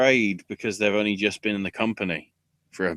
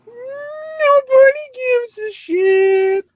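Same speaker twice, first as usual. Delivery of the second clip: much higher, louder, very slow drawn-out jingle voice; mock sound drop; 10 dB louder, much slower than usual. Imitating the show's own sound effect for the co-host, stretched jingle voice. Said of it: "That was just me saying it".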